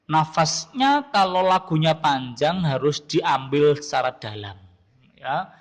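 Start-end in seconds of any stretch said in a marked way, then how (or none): none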